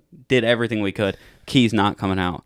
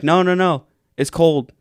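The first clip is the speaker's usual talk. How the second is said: high, loud hasty denial; retold defensive plea; urgent protest; 8 semitones higher, 7 dB louder than usual. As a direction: protest innocence to a cop. Protesting to the accusing cop.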